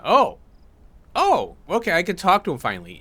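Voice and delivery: sudden high, loud "oh"; real delight, voice jumps up; surprised. Real, not acted. NPC finally answers him.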